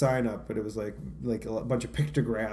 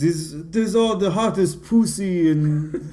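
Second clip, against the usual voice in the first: loud, slow French strip-club barker; French accent; 7 semitones higher, 7 dB louder, slower than usual. Retold or imitated. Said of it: "give it a whirl".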